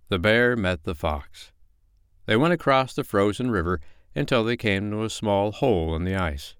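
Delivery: usual speaking voice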